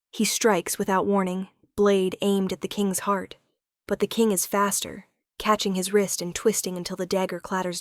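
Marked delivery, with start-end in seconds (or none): none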